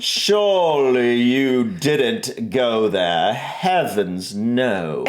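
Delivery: very slow, drawn-out mock British lady with Southern drift; exaggerated British-Southern society accent; posh accent, half Southern. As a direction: stretch it like a scandalized society lady. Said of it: "like, a Southern woman".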